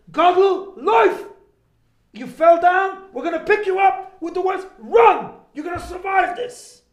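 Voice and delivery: loud, high rallying shout; rousing call. Real, not acted. Rabbi rallying the audience to support the sick, a rousing shout. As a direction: lift the whole room with it.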